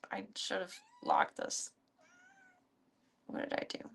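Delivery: whispered mutter to herself; whispering. Real, not acted. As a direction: breathe it under the breath.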